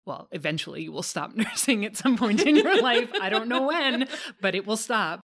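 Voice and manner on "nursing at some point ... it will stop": loud, amused self-correction through laughter; laughing. Real, not acted. Host correcting herself on when nursing ends, laughing. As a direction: laugh through the words, voice rising.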